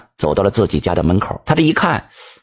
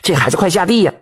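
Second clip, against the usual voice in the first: mild call toward the next room, higher; lightly called out to wake the daughter; an understated mother's call to the inner room; 6 semitones higher than usual. A narrator's reading. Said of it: "朝着屋里喊了".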